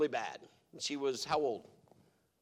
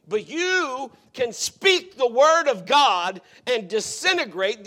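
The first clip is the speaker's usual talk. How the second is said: louder, higher, driving sermon voice; full-force preaching, some words slowed for weight; fiery preaching; 7 semitones higher, 10 dB louder than usual. Acted. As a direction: preach it hard to the whole room.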